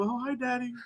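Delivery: put-on soft, high child's greeting; imitated little kid. Dad imitating what his child did, soft high kid voice. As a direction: say it small and innocent. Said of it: "all she did was go".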